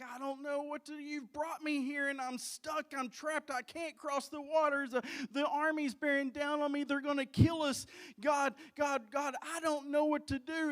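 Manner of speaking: desperate appeal building toward a shout; panicked crying out. Acted. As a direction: act out a mounting, trapped panic. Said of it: "Moses is crying out to God".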